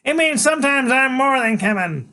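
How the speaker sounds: high, loud, slow fierce declaration. Acted. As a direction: stay fierce and defiant, slowly. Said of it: "still acting really fierce".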